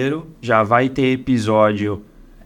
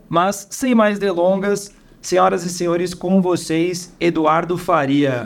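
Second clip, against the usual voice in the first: grand entrance announcement; 4 semitones higher than usual. Acted. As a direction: announce the guest like a ring MC. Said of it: "vamos fazer uma intro boa aqui pro nosso convidado".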